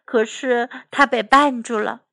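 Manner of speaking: ordinary delivery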